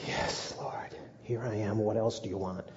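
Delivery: whispered, tired yes to the Lord; a grudging, hushed answer; weary reply. Acted. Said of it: "it might have been".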